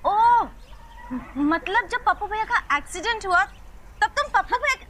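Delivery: high-pitched, dawning disbelief; shocked realisation. Acted. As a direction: blurt it out as it dawns on you. Sudden realisation, then cut off.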